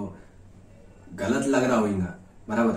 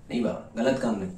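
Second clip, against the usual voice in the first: usual speaking voice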